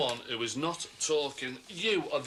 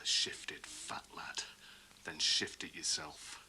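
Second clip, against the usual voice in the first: a soft, nasty whispered jibe; menacing whisper; 10 semitones lower, 6 dB quieter, slower than usual. Acted. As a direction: breathe it out as a sneering taunt. Parting threat in a quarrel.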